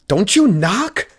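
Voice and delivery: high, loud, drawn-out shriek. Acted. Red caught in her underwear, shrieking at Tobias. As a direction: shriek it in startled outrage. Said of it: "and shrieked".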